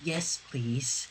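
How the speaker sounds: a bashful little yes; tiny voice. Acted. Fluttershy shyly saying yes to Sunset's date, in a tiny soft voice. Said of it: "speaking out in a tiny".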